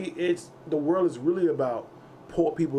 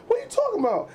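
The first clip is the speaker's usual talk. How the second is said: much higher defensive dad. Retold or imitated. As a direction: play dad caught out. Dad voiced in story.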